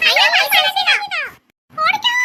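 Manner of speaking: high, loud, slow scream of fright; child shrieking on seeing the mermaid; terrified scream. Acted. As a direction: shriek it out in terror. They discover a mermaid.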